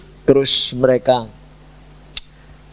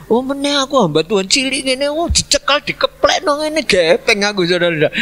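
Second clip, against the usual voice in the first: mock panic; 10 semitones higher than usual. Preacher fears being slammed.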